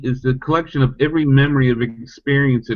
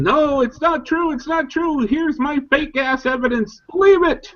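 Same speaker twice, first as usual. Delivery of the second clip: much higher, hammed-up mocking voice; mock screaming; 13 semitones higher than usual. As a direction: parody the sideline deniers in a high mock cry.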